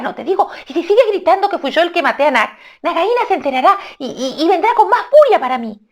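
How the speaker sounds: high urgent hushing voice; Rikitiki hushing. Acted. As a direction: mongoose shushing the bird. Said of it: "dialogue reply".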